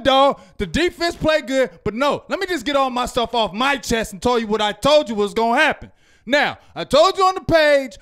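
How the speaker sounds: normal talking voice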